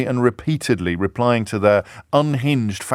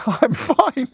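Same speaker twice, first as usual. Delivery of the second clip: chirpy reply; 12 semitones higher than usual. Retold or imitated. Wendy's reply, high funny voice.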